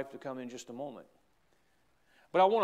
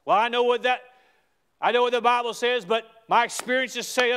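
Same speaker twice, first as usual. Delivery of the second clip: high, loud protesting doubter; doubting believer; 8 semitones higher, 9 dB louder than usual. Retold or imitated. Preacher voicing a doubter pushing back with his experience, high and loud. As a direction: argue back against the Bible.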